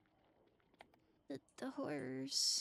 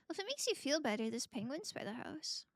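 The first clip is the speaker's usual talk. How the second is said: usual speaking voice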